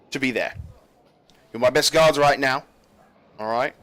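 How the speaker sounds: brisk briefing from the captain; stern general. Acted. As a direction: command the guards firmly.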